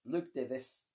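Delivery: a hint of a South African accent. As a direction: say your name in a mild South African accent. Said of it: "in a South African accent".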